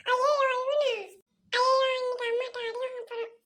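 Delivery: put-on high, tearful child voice; dismayed little boy. Boy sees the complaining visitor arrive, high dismayed whine. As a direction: whimper in dread of trouble. Boy dreads a beating from mother.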